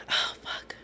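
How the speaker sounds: barely audible whispered curse; dread-filled whisper. Retold or imitated. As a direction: whisper it to yourself, reliving the dread. Voicing her dread as a child.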